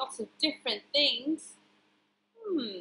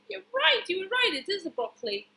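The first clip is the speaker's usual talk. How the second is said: usual speaking voice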